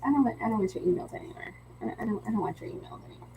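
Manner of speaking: fast, fading mock refusal; dismissive muttering. Brushing off spammy salesy emails, quick fading mutter.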